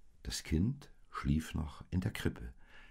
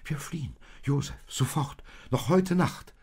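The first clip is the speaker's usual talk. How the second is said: high, pressing plea; alarmed, rising in pitch and force; frightened urgency; 10 semitones higher, 4 dB louder than usual. A narrator's reading. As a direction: urge him to flee at once. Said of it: "rief Maria schaudernd".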